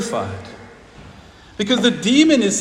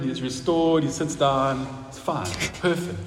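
ordinary talk

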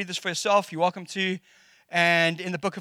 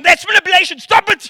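high, loud mock-stern protest; dad jokingly scolding his daughter, high and loud; 13 semitones higher, 12 dB louder than usual. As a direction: pretend to be outraged, half laughing. Dad calling out daughter playfully.

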